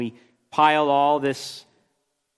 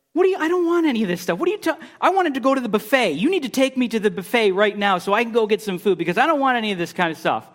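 high, fast, petulant whine; mock whiny high voice, rushing; whiny demanding man; 7 semitones higher, faster than usual. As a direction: mimic a spoiled complainer demanding the buffet. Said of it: "I got mad on this day".

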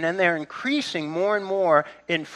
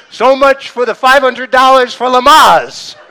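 laughing; 7 semitones higher, 13 dB louder than usual. Real, not acted. Laughing after the story.

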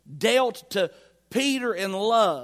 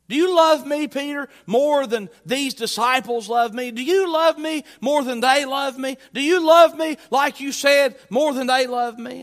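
higher, louder preached question; pointed challenge; 5 semitones higher, 7 dB louder than usual. Acted. Preacher voicing Jesus challenging Peter over the disciples, pointed and loud. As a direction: press Peter hard with each raised, emphatic question.

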